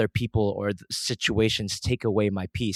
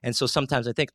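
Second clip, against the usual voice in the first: usual speaking voice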